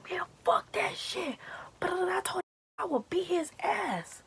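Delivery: high, hushed-strained imitation of a friend; worked-up friend. Friend climbing into the car ready to fight, imitated high and agitated. Friend's angry rant acted out.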